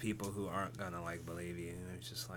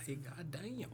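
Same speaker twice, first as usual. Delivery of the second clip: higher, soft mock North Carolina twang; mock Southern accent, pitched up and softened; North Carolinian accent; 7 semitones higher, 9 dB quieter, slower than usual. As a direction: quietly imitate a North Carolinian exclaiming. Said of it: "That's your inner North Carolinian".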